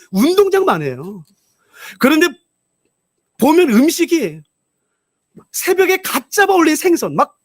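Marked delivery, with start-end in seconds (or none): 5.5-7.4 excited. Retold or imitated. Pastor describes lavish buffet excitedly.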